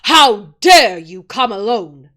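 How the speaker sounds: slow, loud furious witch; the witch rages at Edmund for coming alone; terrible voice. A narrator's reading. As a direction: let her wrath fall on him. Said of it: "in a terrible voice".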